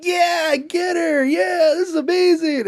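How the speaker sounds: high, loud mock cheering; cheering kids. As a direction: whoop it up like kids egging her on. Cheering kids acted in retelling.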